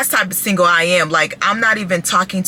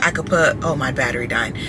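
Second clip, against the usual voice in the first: ordinary talk, nothing marked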